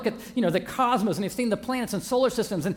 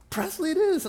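bright and much higher, a touch mock-grand; lightly hammed-up cheer, much higher; cheerful decision; 11 semitones higher than usual. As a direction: proclaim the name like a small ceremony. Naming the puppy, retold.

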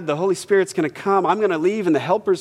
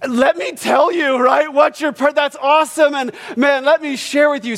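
high, loud, eager burst; can't hold it in, louder and higher; excited shout; 8 semitones higher, 4 dB louder than usual. Retold or imitated. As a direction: pastor bursting to tell the man his purpose, excitedly. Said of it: "I'm sitting there going".

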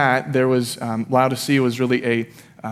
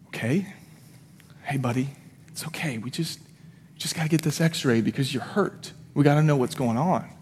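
soft, hushed reassurance, acted out; gently calm a hurt child; soothing father; 7 dB quieter than usual. Father soothing hurt son in story.

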